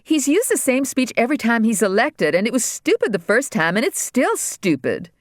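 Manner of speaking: put-on a scornful girl, slowing on stupid. Daughter scorning the speech to her mother, disgusted. Regina voiced higher than narration.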